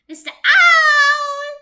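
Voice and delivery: long high loud call up to the treetop. Acted. Mouse calling up to the owl in the tree, long loud call.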